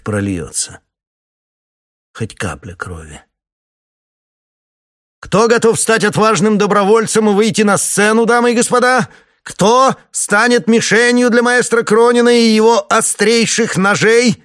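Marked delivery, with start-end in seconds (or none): 5.2-14.4 booming circus ringmaster. A narrator's reading. Ringmaster calls for volunteers.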